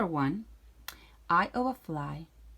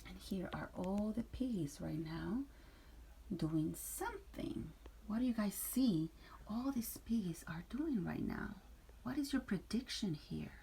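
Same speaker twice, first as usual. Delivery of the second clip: soft, whispered question to the class; hushed teacher question; 8 dB quieter than usual. Real, not acted. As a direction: invite guesses softly. Teacher asking class quietly.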